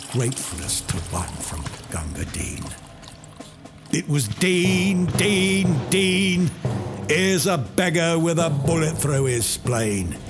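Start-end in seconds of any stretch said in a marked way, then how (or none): 4.3-10.2 higher, louder pleading cries; desperate calling. Acted. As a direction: shout for help for the wounded man. Calling for water for wounded.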